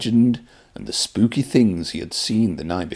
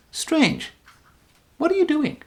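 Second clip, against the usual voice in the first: much higher, baffled question; puzzled. Acted. Host baffled by silent Pup, puzzled high question. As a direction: ask it like the puppet's gone odd.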